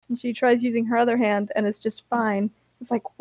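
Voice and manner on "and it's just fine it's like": ordinary delivery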